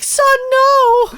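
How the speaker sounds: squeaky pleading mother; mom voice. Retold or imitated. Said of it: "Air Bud's mom was like".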